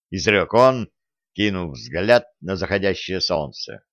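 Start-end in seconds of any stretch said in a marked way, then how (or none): none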